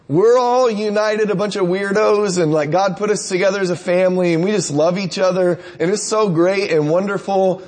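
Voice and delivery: beam with upbeat delight at the church; gushing enthusiast. Retold or imitated. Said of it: "that talked like this all the time".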